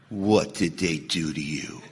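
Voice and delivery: hushed, very low and slow, gravely concerned; mock-serious sponsor. Imagined sponsor hearing of a sponsee's anger, low grave concern. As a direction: play the sponsor turning deadly serious, soft and low. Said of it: "he got real serious and went".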